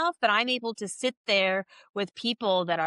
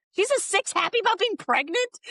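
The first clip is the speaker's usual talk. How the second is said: pitched way up in disbelief; incredulous; 10 semitones higher than usual. Retold or imitated. Hearing her friend rated only a six, incredulous and high. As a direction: she can't believe only a six.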